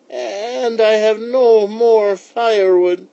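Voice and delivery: put-on high, loud, slow old lady voice; slow high-pitched old-woman character voice. A narrator's reading. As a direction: play the old woman, stretching words out high.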